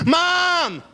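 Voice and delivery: high shout of Mom; kid calling out. Retold or imitated. Daughter yelling to her mom in the kitchen, high-pitched call. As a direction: call Mom across the house like a little girl. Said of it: "She's like".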